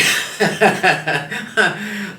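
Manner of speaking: high, loud burst of laughter; laughing. Real, not acted. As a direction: laugh out at how easy it was. Remarks school was really easy.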